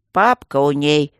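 slow, loud lecturing; mentoring tone. A narrator's reading. Guardian lecturing his colleague, slow emphatic tone. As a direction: explain it slowly, like a mentor. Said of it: "менторским".

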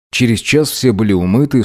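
ordinary delivery

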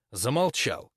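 plain reading voice

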